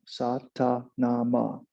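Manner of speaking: Satanama drawn out syllable by syllable; slow mantra demo. Shown as a demonstration. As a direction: sound out Satanama slowly. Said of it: "So it's called Satanama".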